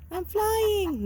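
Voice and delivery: chicken's voice. Pretend. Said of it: "voicing the chicken".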